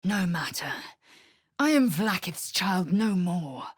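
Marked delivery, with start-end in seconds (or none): 0.0-0.7 hushed, secretive voice; whispered reply. Acted. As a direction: keep it under your breath.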